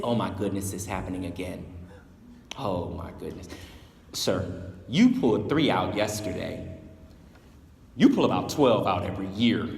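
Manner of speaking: low tired elders shrugging off another rescue; weary resignation. Acted. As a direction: say it like it happens every day.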